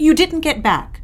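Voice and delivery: high, sharp disbelief; mother catching the daughter out; shocked. Acted.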